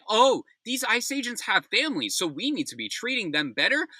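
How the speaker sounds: high mock whine of his words; mocking quote. Mocking Homan's TV quote, sarcastic. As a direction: parody his excuse with scorn. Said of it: "go on national television and say".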